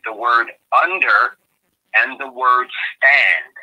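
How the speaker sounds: slow and deliberate, pause between UNDER and STAND, both stressed; dissecting the word. Shown as a demonstration. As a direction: draw out "under" and "stand" as two separate parts. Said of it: "we're gonna dissect this now".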